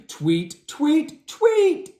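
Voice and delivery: rising high tweets; a little bird tweeting. A narrator's reading. Bird sound.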